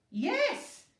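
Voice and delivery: loud high exasperated shout. A narrator's reading. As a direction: yell it, patience gone. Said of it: "he shouted".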